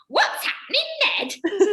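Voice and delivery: very high, drawn-out question; mock bewildered call. Actress asking pianist mid-finale what is happening, mock bewildered. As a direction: imitate a baffled actress calling out.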